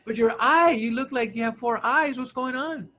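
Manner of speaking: high-pitched puzzled questioning; imitated girlfriend. Girlfriend asking about his swollen eye, imitated high voice. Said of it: "go see your girlfriend".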